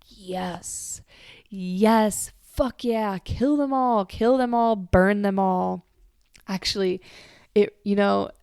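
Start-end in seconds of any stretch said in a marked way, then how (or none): 0.1-1.0 whisper. Retold or imitated. Kali's voice inside her. 1.5-5.8 louder, rising to a gleeful kill chant; louder savage chant of burn them all; bloodthirsty cheer. Retold or imitated. Voicing Kali's bloodlust.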